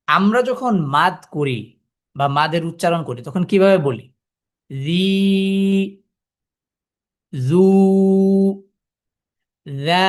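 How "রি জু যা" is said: রি, জু, যা each drawn out with no catch; long, smoothly held vowels on রি, জু, যা; madd elongation. Shown as a demonstration. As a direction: let the vowel in রি, জু, যা flow on unbroken. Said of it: "আওয়াজটা কিন্তু স্বাভাবিকভাবে চলছে".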